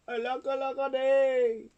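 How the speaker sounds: high, singsong mock lament; plaintive cry. A narrator's reading. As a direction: wail it in a high character voice. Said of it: "And he cried".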